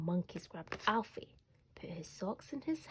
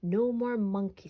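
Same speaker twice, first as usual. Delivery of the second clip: louder, firm mother's warning; firm mum; 2 semitones higher, 8 dB louder than usual. A narrator's reading. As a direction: lay down the law to her son. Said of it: "said Mum".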